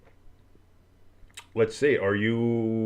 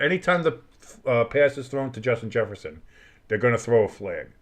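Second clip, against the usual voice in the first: normal talking voice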